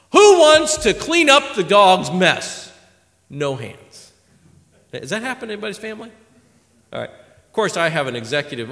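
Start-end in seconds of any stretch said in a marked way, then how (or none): 0.1-2.7 slow, loud, raised parent call; calling for volunteers. Retold or imitated. Parent asking the household to clean up after the dog, drawn-out call. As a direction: draw out the question to the whole house.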